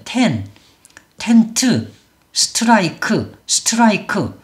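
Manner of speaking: 텐트 and 스트라이크 with every written syllable voiced; Korean-style pronunciation. Shown as a demonstration. Teacher reads words as if Korean, every syllable sounded. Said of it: "한글처럼 하면".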